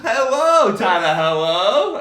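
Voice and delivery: high, loud giggly hello; laughing, high. Pretend. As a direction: laugh through a silly greeting. Mock greeting to Tyler.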